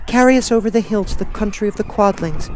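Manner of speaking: put-on high, worried child voice; girl voice. Dorothy asking friends what to do, high worried girl. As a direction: ask anxiously.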